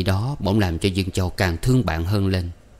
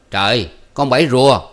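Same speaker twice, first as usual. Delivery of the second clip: startled, raised-voice greeting; loud exclamation; 4 semitones higher, 7 dB louder than usual. A narrator's reading. Uncle seeing his niece arrive, surprised shout. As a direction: cry out in surprise, loud and high. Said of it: "rồi Hai Lọt la".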